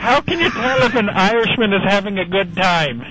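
broad comic Irish brogue; put-on Irish accent. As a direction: play a jokey stage Irishman with a brogue.

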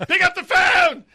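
loud, high desperate shout; yelling at the phone. Acted. Owner yelling at the voicemail to pick up. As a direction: lose it and yell into the voicemail. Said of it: "You are losing it".

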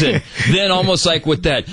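ordinary delivery

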